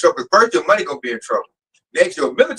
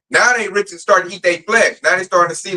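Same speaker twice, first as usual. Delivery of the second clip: ordinary talk, nothing marked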